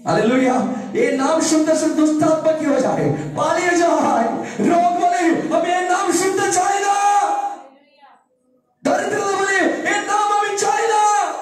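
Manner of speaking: high, loud shouted sermon; rising revival shout; full-force preaching. Acted. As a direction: preach it out to the whole hall.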